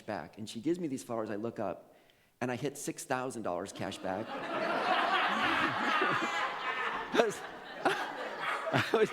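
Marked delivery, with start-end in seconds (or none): 4.3-7.1 high, loud burst of real laughter; full-throated real laugh. Real, not acted. Laughing at the six thousand dollars. 7.1-9.1 words squeezed out through laughter; slow, breathy laugh-talk. Real, not acted.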